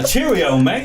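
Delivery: high, loud British attempt slipping into Australian; badly faked British-Australian accent; botched British accent. Guest trying British for the laughing host, botched British accent. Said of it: "why Australian came in there".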